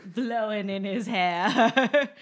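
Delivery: hammed-up romance novel narration; romance narrator voice. Mock romance-novel description.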